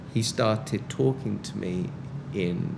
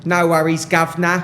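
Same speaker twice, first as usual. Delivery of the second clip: loud, higher hammed-up Cockney; mock British accent; 6 semitones higher, 9 dB louder than usual. Annoyed book seller brushing off a jogger, mock British accent. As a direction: belt out a bad imitation British accent. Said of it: "He went".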